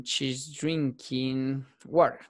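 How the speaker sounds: very slow, each word drawn out; slow teaching demo. Shown as a demonstration. Teacher modelling English slowly for students. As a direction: spell the sentence out slowly for beginners. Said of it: "Vamos a hacerla sencillo".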